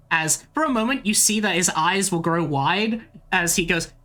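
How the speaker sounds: ordinary talk, nothing marked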